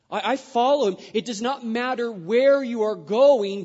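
high, loud fervent sermon; preaching at full force. Acted. Preacher urging obedience through dark valleys, loud and high. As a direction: drive it home with full conviction.

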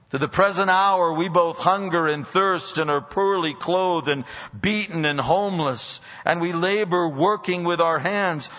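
fervent pulpit delivery; preaching. Acted. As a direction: build passion through the list of sufferings. Preaching at full force.

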